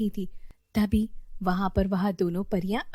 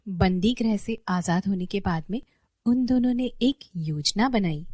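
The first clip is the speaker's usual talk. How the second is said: ordinary delivery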